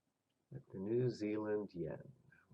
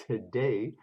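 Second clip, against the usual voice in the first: ordinary delivery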